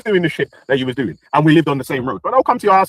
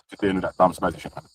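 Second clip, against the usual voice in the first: softer, whispered, muttered aside; 10 semitones lower, 7 dB quieter than usual. Real, not acted. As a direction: drop it to a whisper, just for yourself. Drops to aside about opponent.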